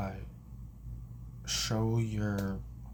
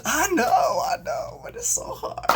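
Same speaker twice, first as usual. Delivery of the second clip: very high hammed-up lament; mock wailing; 6 dB louder than usual. Coworker admitting the struggle to caller, mock high wail. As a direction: wail it high, starting loud.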